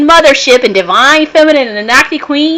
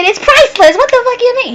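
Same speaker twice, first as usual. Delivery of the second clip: much higher, incredulous retort; indignant disbelief. Retold or imitated. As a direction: fire it back at the caller, baffled. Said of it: "And I said".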